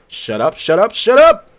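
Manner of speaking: rising to a loud, high shout; yelling shut up. Acted. Lonely man yells at the voice in his head, loud. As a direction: build until the last shut up bursts.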